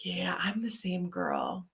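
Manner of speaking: slow whispered confession; whispered aside. Real, not acted. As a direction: lean in and whisper it. Quiet confiding aside to co-host.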